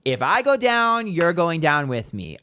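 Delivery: high, loud mocking threat; spiteful threat. Imitating [name]'s threat to [name], loud and high. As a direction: throw the threat out like a petty ex.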